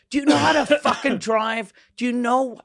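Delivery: high, loud mock outburst at the driver; exasperated yell. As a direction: blow up at the hopeless driver. Yelling at bad driver.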